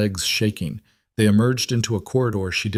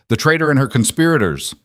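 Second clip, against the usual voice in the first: shout; 5 semitones higher, 5 dB louder than usual. A narrator's reading. Guard shouting on spotting fugitives.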